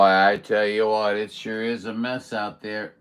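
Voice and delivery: ordinary talk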